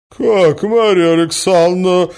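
high, loud offended woman; indignant outrage. A narrator's reading. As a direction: pounce on it, scandalized. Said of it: "подхватила Наталья Дмитриевна". (возмущённо, высоким громким голосом)

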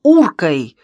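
loud, drawn-out indignant accusation; heated, emphatic reproach; angry shout. A narrator's reading. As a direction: accuse them with scornful anger. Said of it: "сердито крикнул Ящиков".

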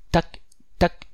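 high, whispered tac tac tapping; tapping sound. A narrator's reading. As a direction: imitate a beak tapping the glass. Bird tapping on the window.

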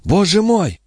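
high-pitched startled exclamation; acted high gasp of astonishment in words; exclaiming. A narrator's reading. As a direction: cry out in surprise, pitch jumping up. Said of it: "воскликнула она".

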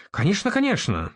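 high-pitched, placating agreement; conciliatory. A narrator's reading. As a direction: agree quickly to keep the peace. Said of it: "примиряюще закивал гонзамистр". (примиряюще)

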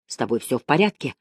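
ordinary delivery, nothing marked

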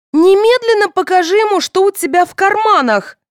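very high, loud command; shouting. A narrator's reading. Daughter ordering mother to empty pockets, shouting. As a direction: order frantically at the top of your pitch. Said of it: "закричала Соня".

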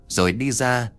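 usual speaking voice

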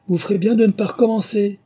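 high, loud warning; shouting. A narrator's reading. Alice shouting a warning at the animals, high and loud. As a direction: yell a warning out. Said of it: "elle cria".

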